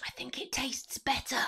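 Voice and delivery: soft whispered verdict; whispering. Real, not acted. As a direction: whisper it like a secret. Whispered verdict while tasting.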